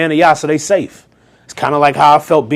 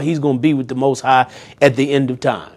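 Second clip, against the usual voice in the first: usual speaking voice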